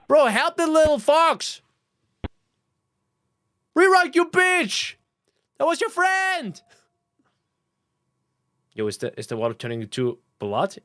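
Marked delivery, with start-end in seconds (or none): 0.1-6.6 angry yelling at the screen. Real, not acted.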